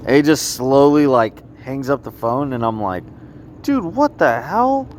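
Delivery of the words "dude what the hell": incredulous outburst. Retold or imitated. Frustrated retelling of hospital call.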